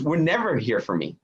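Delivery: ordinary talk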